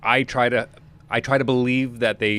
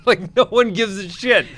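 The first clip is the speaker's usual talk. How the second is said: normal talking voice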